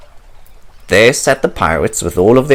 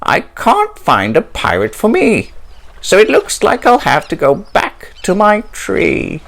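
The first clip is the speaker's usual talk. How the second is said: sighing; 8 semitones higher than usual. Acted. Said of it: "Sighed Polly".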